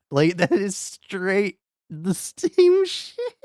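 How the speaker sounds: high, loud, slow laugh-cry; laughing wail. Real, not acted. Streamer laugh-crying over the same ending trope. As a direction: laugh it out in pained disbelief.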